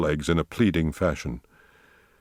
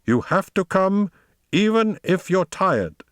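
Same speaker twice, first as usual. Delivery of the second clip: high, insistent command; imperious high-pitched cry; masterfully raised voice; 13 semitones higher than usual. A narrator's reading. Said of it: "cried Tobias in a masterfully raised voice".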